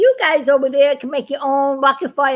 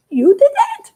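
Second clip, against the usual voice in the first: much higher and louder, laughing disbelief; a squeaky cartoon gasp of wonder; amazed; 14 semitones higher, 5 dB louder, slower than usual. Astronaut learns the alien fixed his ship, squeaky amazement.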